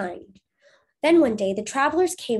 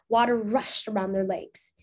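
plain reading voice

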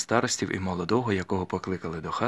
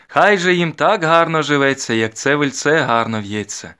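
loud, high, slow proclamation; ringing, drawn-out bridesmaid's wish; wedding blessing; 7 semitones higher, 9 dB louder, slower than usual. A narrator's reading. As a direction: proclaim it slowly like a wedding toast.

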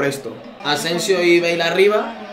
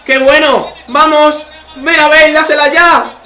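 high loud calls at the screen; excited urging; 8 semitones higher, 6 dB louder, slower than usual. Real, not acted. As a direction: urge the player on. Urging on an attack.

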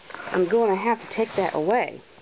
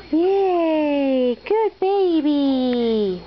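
loud, slow, drawn-out cheer; 3 semitones higher, 3 dB louder, much slower than usual. Real, not acted. Cheering baby's success.

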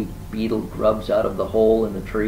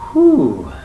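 loud, higher whew of relief; acted relief; 5 semitones higher, 10 dB louder than usual. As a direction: let out a big relieved whew. Rabbit relieved it's no snake.

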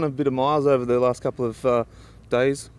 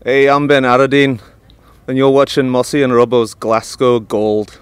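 loud attempt at a Scottish accent; loud put-on Scottish announcer; 9 dB louder than usual. Shown as a demonstration. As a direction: belt out the show intro in a Scottish accent. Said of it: "your name in your best Scottish accent".

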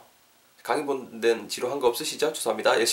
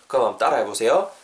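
ordinary delivery

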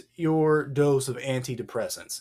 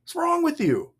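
exasperated; 6 semitones higher than usual. Acted. Acts God's exasperation.

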